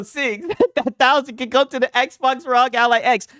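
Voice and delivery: ordinary talk, nothing marked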